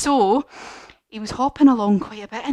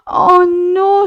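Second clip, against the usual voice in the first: higher, louder oh no; mock dismay; 8 semitones higher, 8 dB louder than usual. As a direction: groan it like bad news. Shop closed dismay.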